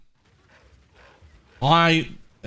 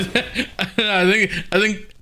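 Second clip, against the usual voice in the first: laughing; 6 semitones higher than usual. Real, not acted. Laughing mid-sentence.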